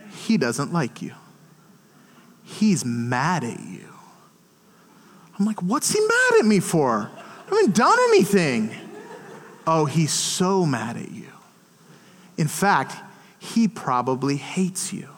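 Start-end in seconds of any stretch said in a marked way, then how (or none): 0.1-3.9 low, slow, soft taunt; acted tempter's murmur; insinuating enemy. Voices the enemy's planted lies. 5.6-8.7 higher-pitched indignant protest; mock-offended retort; defensive protest. Retold defensive reply to accusation. 9.6-15.1 low taunt sinking into a whisper; acted tempter, hushed at the end; insinuating enemy. Voices the enemy's planted lies.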